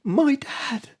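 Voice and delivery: high, soft imitation of the girl; mock daughter's voice. Daughter answering the boy about what stops lava, mock girlish voice. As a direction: put on a small, high-pitched film-daughter voice. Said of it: "it cuts to his daughter".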